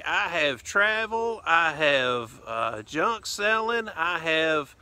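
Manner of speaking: a mock Southern accent, laid on for the intro; put-on southern accent. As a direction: show host greeting fans in an exaggerated Southern accent. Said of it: "a southern accent I'm gonna put on here".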